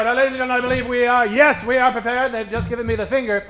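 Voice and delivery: usual speaking voice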